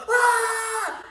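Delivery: sudden high, loud shriek of fright; startled scream. Retold or imitated. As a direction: jump and yelp at a passing car. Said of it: "I'd be like".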